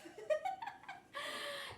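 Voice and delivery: squeaky laugh; high burst of laughter. Real, not acted. Laughs at own joke.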